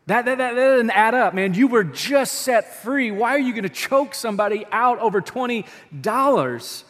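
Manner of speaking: loud, high, stammered objection at first; indignant protest. Acted. Onlookers challenging the forgiven servant, loudly indignant.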